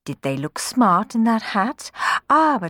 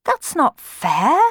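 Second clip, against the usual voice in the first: slow, hurt complaint; grumbling; 7 semitones higher, 4 dB louder, slower than usual. Acted. Cenerentola complaining to her stepsisters, grumbling. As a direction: protest under your breath. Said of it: "grumbled Cenerentola".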